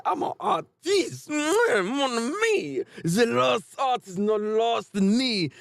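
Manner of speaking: high, slow, lightly Frenchified artiste voice; lightly hammed pompous artist. Mangaka Fujimoto boasting about his art, slow pompous artiste. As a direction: preen like a vain painter, savouring each word. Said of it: "Boy said".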